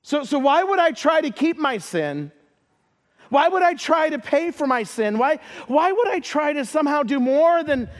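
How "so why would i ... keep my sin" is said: usual speaking voice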